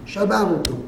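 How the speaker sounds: ordinary talk, nothing marked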